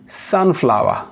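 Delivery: ordinary talk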